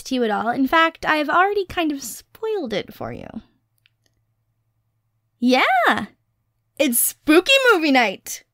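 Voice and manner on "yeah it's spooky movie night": loud, bright announcement; excited reveal. Real, not acted. Announcing movie night to partner, excited. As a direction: burst out with the news.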